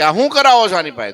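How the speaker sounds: sharp, high, fast rebuke; scolding question. Retold or imitated. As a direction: demand it with indignation. Maharaj scolds the household.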